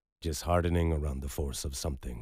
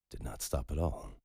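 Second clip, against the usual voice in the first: usual speaking voice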